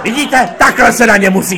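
high, loud crowing; imitating a director loudly pleased with himself; triumphant director. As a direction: announce victory still at high pitch. Director triumphant after the tirade.

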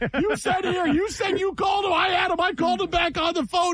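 high shrieking rage at staff; furious shout. Retold or imitated. Producer screaming in control room.

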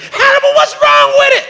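high, squealing imitation of angry girls; high mock girl whine; shrill girl voice. Girls screaming at him in retold scene.

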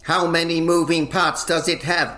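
normal talking voice

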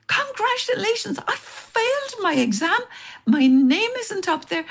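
high, choked-up retold dismay; high, tearful mock despair; upset disbelief. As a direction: replay the heartbreak of thinking you failed. Hearing she failed, upset.

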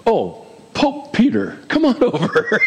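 playful summons dissolving into a laugh; mock deference, laughing. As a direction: crack up while hailing someone grandly. Jokes about titles among disciples, laughing.

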